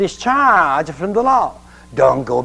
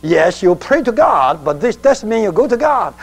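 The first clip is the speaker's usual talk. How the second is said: ordinary delivery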